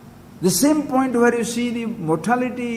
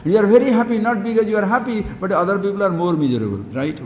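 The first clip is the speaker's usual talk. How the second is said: ordinary talk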